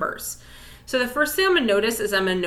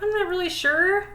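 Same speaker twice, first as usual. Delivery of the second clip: acted uncertainty; 10 semitones higher than usual. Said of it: "even if you are like".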